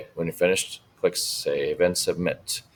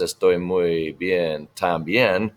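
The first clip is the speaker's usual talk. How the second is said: slow modeled line; much slower than usual. Shown as a demonstration. Teacher voicing Señor Martinez slowly.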